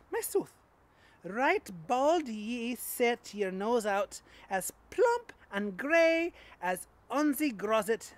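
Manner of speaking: Scottish accent poetry reading. Shown as a demonstration. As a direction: recite the poem in a broad Scottish accent. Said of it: "I am going to read it in a Scottish accent".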